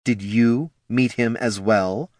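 slow, icy question; controlled, hard interrogation; cold and hard. Acted. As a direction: ask it like iron, drawn out. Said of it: "cold and hard as iron".

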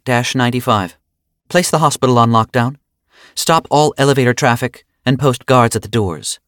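crisp official order over the link; authoritative order. Acted. Sola orders lockdown.

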